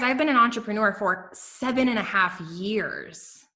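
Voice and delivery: ordinary talk, nothing marked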